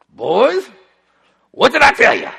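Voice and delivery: put-on the father returns, warning the boys sternly; stern father's tone. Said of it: "he has that tone".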